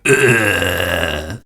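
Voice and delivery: short clown groan; Krusty groan. Retold or imitated. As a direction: give a tired Krusty groan. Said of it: "the big Krusty groan".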